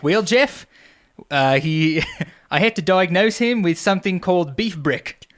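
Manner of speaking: laughing through a bad Australian accent; hammed-up Australian doctor, high and loud; terrible Australian accent. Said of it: "That's my terrible Australian accent".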